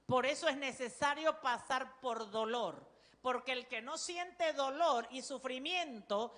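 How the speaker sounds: normal talking voice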